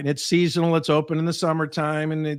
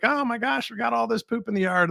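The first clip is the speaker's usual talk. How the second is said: higher, gushing a little; mock excited friend; 8 semitones higher than usual. Customer recommending the poop-scoop service, mock gushing. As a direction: play an enthusiastic neighbour. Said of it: "and they're like".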